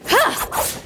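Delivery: sharp high breathy strike cry; battle cry. Acted. Game master crying out on the final strike. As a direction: bark out a strike.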